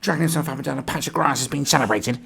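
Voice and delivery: high, loud mock outrage; hammed-up, high-pitched indignation; mock upset. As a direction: read it as someone really, really upset. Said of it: "this person's really, really upset".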